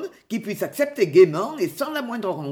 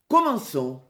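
firm call, only slightly raised; loud call. A narrator's reading. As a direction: give a restrained storybook shout to start. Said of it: "Petit-Jean cria d'une voix forte". (appel ferme, d'une voix un peu forte)

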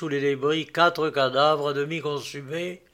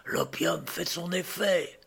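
slow, softened half voice; hushed, unhurried aside; undertone; 6 dB quieter, slower than usual. A narrator's reading. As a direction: confide it quietly and slowly. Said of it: "dit Vaninka à demi-voix".

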